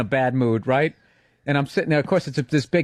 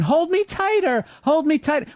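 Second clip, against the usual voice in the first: put-on whining toddler; 12 semitones higher than usual. Toddler pleading to be held, high mock whine. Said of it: "he's going".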